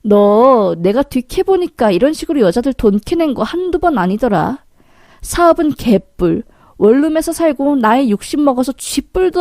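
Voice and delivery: scornful, swinging telling-off; acted indignant rant; scolding tirade. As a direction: dress down a swindler, then order them off. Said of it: "욕을 한 사발 퍼부었어요".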